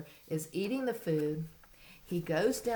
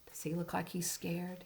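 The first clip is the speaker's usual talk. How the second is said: whispered question; whispering; 7 dB quieter than usual. Real, not acted. Suspense question to child.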